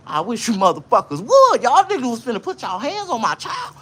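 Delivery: mother defending her son to the principals, furious; mama going off. Retold or imitated. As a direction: threaten them like an angry mother. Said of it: "my mama went off".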